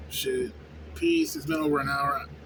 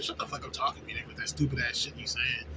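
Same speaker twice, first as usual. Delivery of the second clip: whispered inner thought; 5 semitones lower, 6 dB quieter than usual. Retold or imitated.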